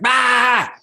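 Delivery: loud, high imitated yell of frustration; frustrated scream. Retelling a man screaming out in the room, frustrated yell. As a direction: let out a short exasperated scream. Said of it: "just screamed out loud, like, a frustration".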